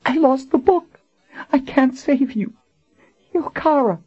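high woman's voice, broken but firm; tearful insistence. Acted. Dying Colin insists Richard save Kara, tearful. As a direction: insist through tears.

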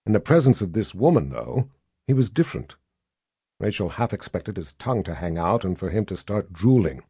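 ordinary delivery, nothing marked

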